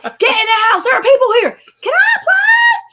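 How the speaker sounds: high, loud mock shout between mom and kid; retelling a mom hollering at her naked son; yelling. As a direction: shout it across the yard, urgent. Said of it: "he's just yelling across".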